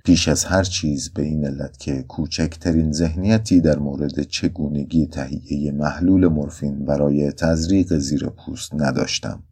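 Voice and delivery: plain reading voice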